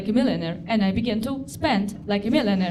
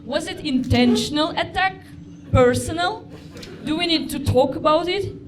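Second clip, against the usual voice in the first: mock outrage; 4 semitones higher than usual. Outraged at farting taxi driver.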